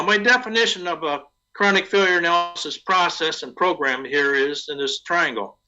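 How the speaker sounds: ordinary delivery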